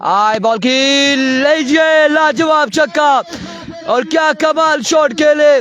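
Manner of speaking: rising live call of a six; excited cricket commentary. Real, not acted. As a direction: build it up as the ball flies.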